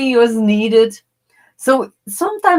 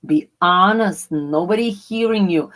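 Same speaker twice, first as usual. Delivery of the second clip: normal talking voice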